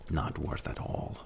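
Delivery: whispered aside. Real, not acted. Whispered repeat to self.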